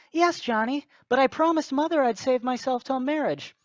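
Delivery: high, prissy mock girl voice, hammed-up; mock girlfriend voice. Mocking the girlfriend as she fends off her boyfriend, high mock girl voice. Acted teen couple, girl's voice.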